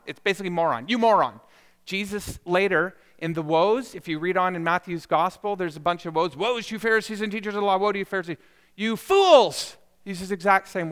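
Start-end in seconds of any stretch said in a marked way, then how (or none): 0.9-1.4 louder, punched-out name-calling; mock angry insult. Preacher acts out someone insulting another, mock angry. Throws the same word as insult. 6.4-9.8 acted rebuke building to a loud cry; denouncing. Preacher voices Jesus condemning the Pharisees, building denunciation.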